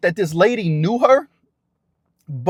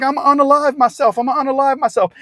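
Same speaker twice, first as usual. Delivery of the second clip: high, desperate retold scream; imitated frantic cry; 7 semitones higher than usual. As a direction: voice the woman's panic, pitched up. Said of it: "she's like".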